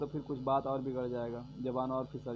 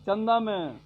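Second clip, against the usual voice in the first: emphatic rant; 5 semitones higher, 7 dB louder than usual. Real, not acted. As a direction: hammer the point home, louder and higher. Sarcastic rant on rich donors. (ज़ोर देकर ऊँची आवाज़ में तंज)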